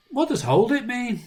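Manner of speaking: put-on high puzzled little-boy question; innocent child. Dad voicing his son's question, high. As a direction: ask like a clueless small child. First take of same quote.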